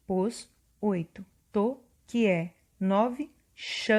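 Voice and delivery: each number then its syllable, "que", "não", "se", "mor", "tal" spoken apart; counting syllables. Shown as a demonstration. Teacher counting the syllables of the line for students. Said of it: "Então, contando".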